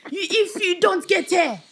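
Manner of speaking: high, loud imitation of an irate dad; angry swearing. Dad talking to the ambulance, mock angry and loud. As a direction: act out a dad threatening down the phone.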